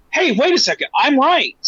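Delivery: high, pushed hey wait a second; indignant I'm right; sudden realisation. Retold or imitated. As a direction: catch yourself, then insist, pitched high. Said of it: "he's like".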